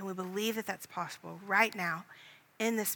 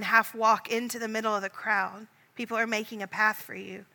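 guided meditation; 4 dB louder than usual. Acted. Leader guiding listeners in imaginative prayer, hushed meditation. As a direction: paint the scene gently for someone at prayer.

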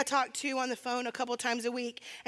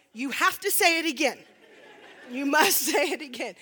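laughing demand; 2 semitones higher, 6 dB louder than usual. Retold or imitated. Demanding he repeat it.

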